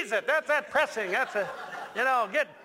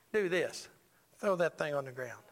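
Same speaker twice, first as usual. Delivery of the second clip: low, softer understated command; God; 6 semitones lower, 4 dB quieter than usual. Acted. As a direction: calmly give a low, quiet instruction. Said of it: "And then God said".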